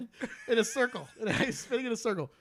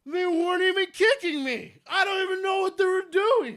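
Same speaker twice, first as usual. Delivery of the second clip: excited shout; 10 semitones higher, 8 dB louder than usual. Real, not acted. Excited retelling of the fight.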